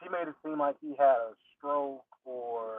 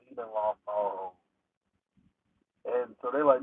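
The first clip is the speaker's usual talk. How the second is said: ordinary talk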